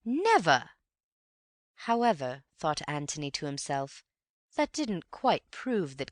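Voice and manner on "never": a high, loud exclamation; emphatic mother. A narrator's reading. Mother insists to Antony, high and loud. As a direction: fling the word out.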